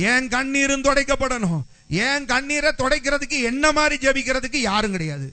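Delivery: high, loud, impassioned pleading preacher; fervent loud prayer. Acted. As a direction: cry out the prayer with full force. Voices Hannah's prayer loudly.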